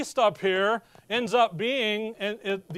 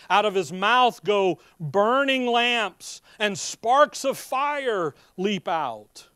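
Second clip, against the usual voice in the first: slow, louder King James recitation; solemn scripture reading; 2 semitones higher, 5 dB louder than usual. Acted. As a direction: proclaim the verses with weight. Reads King James scripture aloud.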